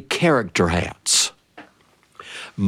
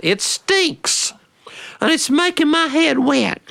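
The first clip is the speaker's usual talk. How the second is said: put-on much higher little boy voice; slower than usual. Little brother telling his sister, high child voice. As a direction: pipe up like a small child.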